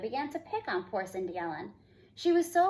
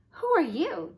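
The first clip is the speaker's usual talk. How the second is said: gasped; 6 semitones higher, 3 dB louder than usual. A narrator's reading. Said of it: "gasped Cindy Ellen".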